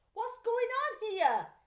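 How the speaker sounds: high, loud and slow, cheerfully calling out from under the board. A narrator's reading. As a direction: call out brightly, unbothered. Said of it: "he called cheerfully".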